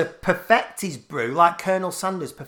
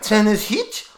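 mock South African; 6 semitones higher, 4 dB louder, slower than usual. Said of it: "I'm from South Africa".

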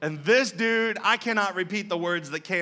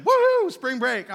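higher, louder and slow woohoo; carefree cheer; 7 semitones higher, 6 dB louder, slower than usual. Retold or imitated. Preacher cheering at the gas pump on spring break. Retells his carefree mood.

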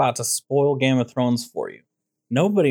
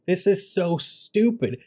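higher, drawn-out exasperation; upset; 6 semitones higher, slower than usual. Retold or imitated. Fan upset at the show's plot, exasperated. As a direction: stretch it out in retold disbelief. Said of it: "I was so upset at that point".

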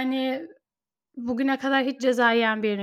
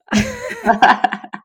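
high laugh; 10 semitones higher, 7 dB louder than usual. Real, not acted. Laughing before resuming topic.

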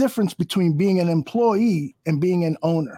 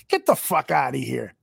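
ordinary talk, nothing marked

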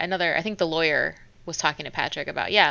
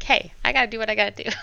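quick, higher mock retort; retold retort; 5 semitones higher, much faster than usual. Elizabeth answering a dig about being lonely, retold retort. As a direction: toss it off fast, imitating her comeback. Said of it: "She's like".

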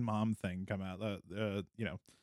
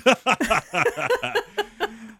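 evil laugh; 13 semitones higher, 9 dB louder than usual. Acted. Villain laugh after taunt.

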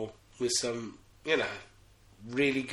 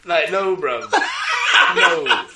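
loud, high, laughing no; laughing shout; 7 semitones higher, 11 dB louder, much slower than usual. Real, not acted. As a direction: laugh out a denial.

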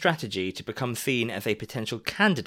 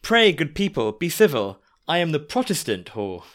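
raised, carrying call from a carriage window; mock shout to crowd; 4 semitones higher, 6 dB louder than usual. Nell calling out to the angry crowd, mock shout. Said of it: "stuck her head out the window to shout".

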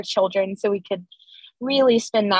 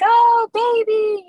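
put-on high, slow, stretched-out child's coo; cooing kids; 13 semitones higher, 6 dB louder, slower than usual. Older kids running in to meet the baby, high drawn-out coo. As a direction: coo at the newborn like an excited child.

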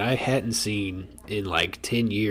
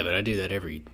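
usual speaking voice

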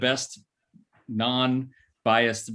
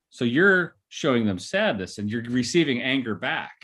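usual speaking voice

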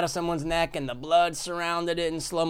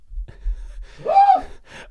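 high laugh; 3 dB louder than usual. Real, not acted. Laughing at shirt count.

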